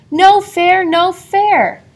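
loud high protest, no fair; crying out. A narrator's reading. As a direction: call it out for all to hear. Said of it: "she cried out for all the animals to hear".

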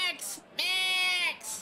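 high yelled name; calling out. Acted. Wife yelling for her husband on the phone, high shout. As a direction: call him from across the room.

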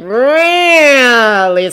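"really" stretched very long and loud; long, emphatic "really"; stress on REALLY. A narrator's reading. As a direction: draw out "really" high and falling. Narrator stresses how badly he lies.

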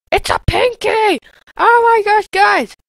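high, loud excited shout; excited yelp. Real, not acted. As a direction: yell it out as the enemy shows up. Spots enemy in game.

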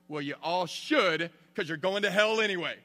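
hammed-up boy preacher damning his class; blunt mock preacher. Seventh grader telling classmates they're going to hell, mock preaching.

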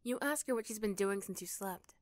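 usual speaking voice

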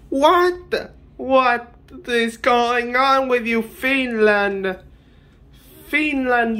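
high-pitched, incredulous scolding; hammed-up indignant telling-off; mock outrage. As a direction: berate Finland in comic disbelief. Mock outrage at Finland's odd answer.

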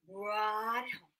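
plain reading voice

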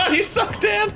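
high, through helpless laughter; laughing jeer. Real, not acted. Player mocking a teammate's blunder, laughing jeer. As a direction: tease through a cackle.

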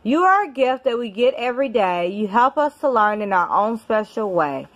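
normal talking voice

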